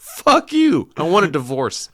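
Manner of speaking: very high, loud comic yell; hammed-up outraged spouse; mock furious outburst. Spouse answering a sick prank by demanding divorce, mock fury.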